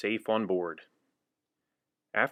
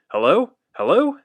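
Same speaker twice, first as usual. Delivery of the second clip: loud, much higher rising hellos; urgent hello; 9 semitones higher, 9 dB louder than usual. Acted. Firemen answering an alarm call, urgent.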